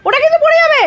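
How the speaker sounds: mother warning son about the statue; alarmed scolding. Real, not acted. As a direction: shout to stop him knocking it over. Child grabbing the Buddha statue.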